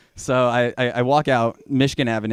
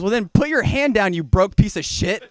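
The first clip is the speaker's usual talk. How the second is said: high-pitched, indignant cabbie; mock outraged driver; exasperated insult; 9 semitones higher, 4 dB louder than usual. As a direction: tell him off in a raised pitch. Driver snaps insult at him.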